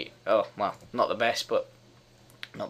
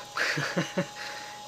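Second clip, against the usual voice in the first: voiceless giggling burst; real helpless chuckle; amused laugh; 4 semitones higher, 5 dB quieter than usual. Real, not acted. Laughs at own stumbling.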